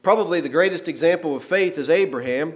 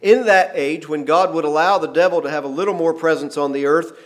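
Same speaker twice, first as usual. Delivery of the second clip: normal talking voice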